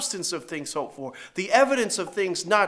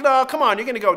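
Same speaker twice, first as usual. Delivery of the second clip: louder, insistent coaxing; loud playful dad urging his girl; 6 semitones higher, 7 dB louder, faster than usual. Retold or imitated. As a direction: push her along like an eager dad. Father urging daughter.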